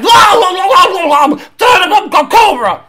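high, loud garbled babble; too thrilled to get the words out, yelling; incoherent excited shouting. Retold or imitated. Host mimics racer cheering the Cobra, excited yelling. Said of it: "he can't get his words out".

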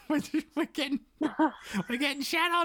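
high, put-on complaining kid voice; whiny kids. Kids complaining to their neglectful father, high and whiny. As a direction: pitch up and whine at Dad like a kid.

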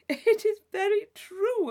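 laughing protest, high and loud. Acted. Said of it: "Isabella laughed".

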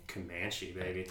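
low, soft, smug boast; cool and smug. Real, not acted. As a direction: say it like a cool brag.

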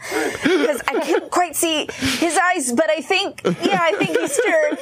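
high voice through laughter; a laughing, pleading phone call; laughing mock plea. Pretend phone call to neighbor.

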